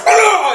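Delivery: high, loud mock roar; lion roar. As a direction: roar out like a film studio lion. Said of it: "and it roars".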